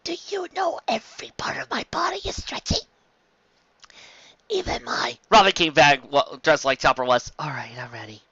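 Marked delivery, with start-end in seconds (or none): 0.0-5.2 drugged conspiratorial whisper. Acted. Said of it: "He whispered". 7.4-8.2 Robin, quiet and gentle; hushed calm nurse; soft reassurance. Acted.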